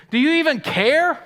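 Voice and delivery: high, loud panicked cry; panicked plea. Acted. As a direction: shout it like the boat is sinking. Disciples panic in storm.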